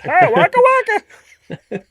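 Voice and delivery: high, loud Muppet greeting; Fozzie Bear voice. Pretend. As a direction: cheer it like the comic bear. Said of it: "A lot of Muppet characters".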